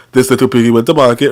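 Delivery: low, measured rhyme delivery; mock little-piggy cadence; nursery-rhyme recital. Shown as a demonstration. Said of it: "I'm sounding like that".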